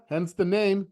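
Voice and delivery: ordinary talk, nothing marked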